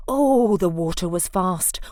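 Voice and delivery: ordinary delivery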